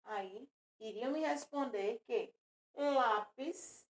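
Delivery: usual speaking voice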